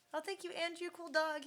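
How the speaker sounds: mock the dog politely; lightly voiced dog. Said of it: "he says".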